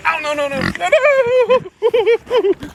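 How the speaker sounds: high alarmed no, no. Real, not acted. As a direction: cry out as the pig escapes. Pig gets out.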